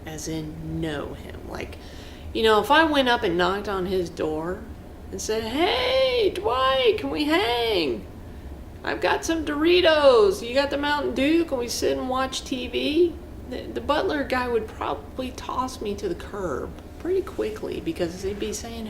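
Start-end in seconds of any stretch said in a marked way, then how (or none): 5.4-13.1 high, loud, eager buddy voice; eager hanging-out ask. Retold or imitated. Fan at Dwight's door, eagerly asking to hang out.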